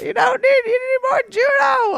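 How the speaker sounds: very high, loud mock scream; mother yelling. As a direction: screech it like an exasperated mom. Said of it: "she yelled at me".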